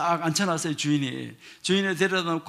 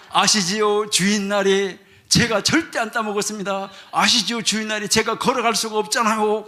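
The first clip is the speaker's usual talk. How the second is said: louder, pleading protest of innocence; 4 semitones higher, 5 dB louder than usual. Retold or imitated. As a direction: insist loudly you did nothing. Worker loudly protests innocence. (주인에게 결백을 큰 소리로 항변)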